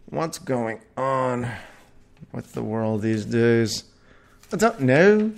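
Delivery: slow, badly faked Irish-Scottish lilt; drawn-out attempt at a Scottish-Irish accent; shitty Irish-Scottish accent. Asking what's going on with the world, bad Irish-Scottish accent. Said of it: "That's my shitty Irish Sc-, Scottish accent".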